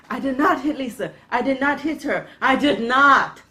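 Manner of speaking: loud, high, hammed-up protest in an odd accent; indignant movie actor. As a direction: imitate a bad actor's overwrought denial. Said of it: "this guy has an awkward accent".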